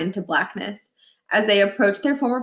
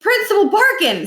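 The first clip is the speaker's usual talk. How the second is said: calling out; 12 semitones higher, 6 dB louder than usual. A narrator's reading. Niles calls out on arrival.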